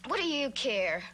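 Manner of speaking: defensive snap. Acted. Woman rebuffing her captive's questions, defensive snap. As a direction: cut him off sharply, pitch jumping.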